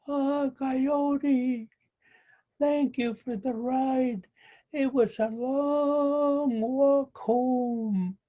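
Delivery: high, slow, smug turtle voice; teasing little turtle. Acted. Little turtle thanks Coyote from the river, high and teasing. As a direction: drawl out the thanks to rub it in.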